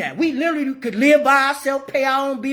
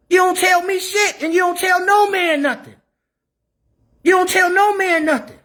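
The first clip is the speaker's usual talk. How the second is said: raised, higher voice, hammering it home; shouting; 9 semitones higher than usual. Real, not acted. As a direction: yell it right at them. Heated rant at women.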